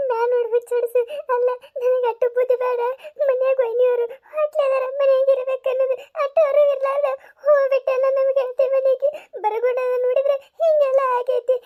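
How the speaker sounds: high, tearful self-blame, close to sobbing; tearful lament. Acted. Lakshmi blaming herself to Neelammakka, tearful high lament. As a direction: wail it out as if it is all your fault.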